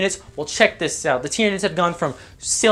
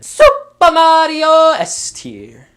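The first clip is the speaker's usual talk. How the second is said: high, loud, stretched-out shout; drawn-out gleeful holler; hammed-up triumphant announcement; 11 semitones higher, 8 dB louder, much slower than usual. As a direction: belt it out like a game-show reveal. Shouted announcement of top tier.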